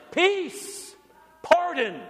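a high, proclaiming call of peace; proclaimed shout. Acted. Pastor voicing God's shout to the nations, high proclaiming call. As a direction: ring out each word high, like a shout from heaven. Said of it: "This is God's shout".

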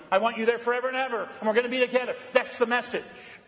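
high-pitched, driving sermon delivery; fervent preaching. Real, not acted. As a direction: preach it with urgency, pitch lifted.

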